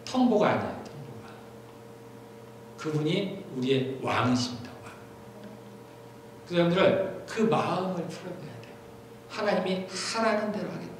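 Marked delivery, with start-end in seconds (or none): none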